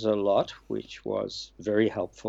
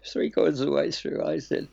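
ordinary talk, nothing marked

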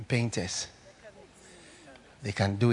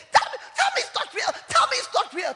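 terrified shrieking; faster than usual. Retold or imitated. Dying auntie clutching Kenneth, shrieking in terror. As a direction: beg in terror at death's door. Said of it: "I'm so frightened".

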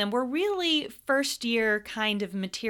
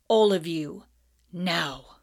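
firm louder command; sharp order; 3 semitones lower, 6 dB louder, slower than usual. A narrator's reading. Snape ordering the class, firm command. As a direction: snap the class into line.

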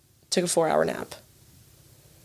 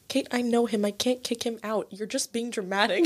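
hushed refusal; 2 semitones higher, 7 dB quieter than usual. Retold or imitated. Acting out friend's private refusal.

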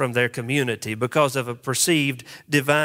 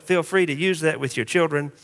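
normal talking voice

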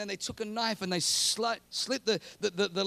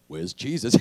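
lower, booming voice of God; 11 semitones lower, 7 dB louder than usual. Pretend. God asking Joseph and Mary if all is well, low and booming. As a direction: speak down as God, weighty and low.

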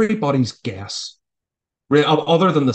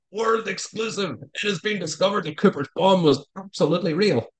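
higher, slowed-down hint of a news announcer; mock news flash; 6 semitones higher than usual. Imagining a phone alert announcing Cooper's bomb, mock newsreader. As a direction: lightly play a breaking-news anchor. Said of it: "a news flash".

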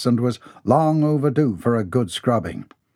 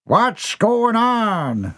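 booming father; 10 semitones higher, much slower than usual. A narrator's reading. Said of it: "a voice boomed".

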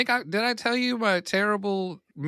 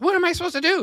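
much higher, frantic mother; a mild imitation of a desperate, furious mom; livid mother; 3 dB louder, much faster than usual. Livid mother quarrels with the clerk.

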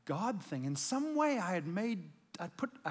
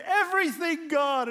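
higher, louder eager cry; eager teen vow; 9 semitones higher, 11 dB louder than usual. Retold or imitated. Teen pastor promising God everything, higher and louder.

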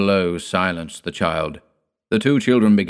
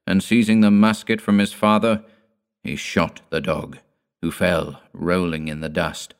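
ordinary delivery

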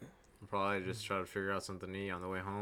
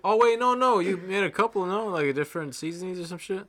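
excited; 10 semitones higher, 14 dB louder than usual. Real, not acted. Stoned friend suddenly remembering.

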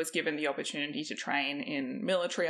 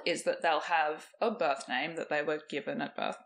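usual speaking voice

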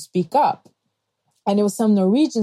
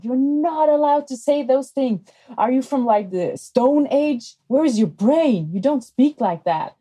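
scolding; 6 semitones higher than usual. Retold or imitated. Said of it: "she screamed at her".

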